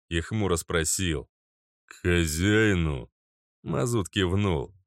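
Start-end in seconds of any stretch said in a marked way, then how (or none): 1.9-3.1 slow gloomy guard. Acted.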